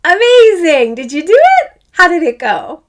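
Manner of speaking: high, loud, delighted cheer; excited praise. Real, not acted. Teacher praising pupils after exercise.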